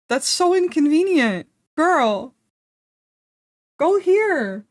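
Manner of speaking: high-pitched, exasperated pleading; exasperated pet scolding. Real, not acted. To the pet on the desk, high and exasperated. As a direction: shoo the pet along in a high voice.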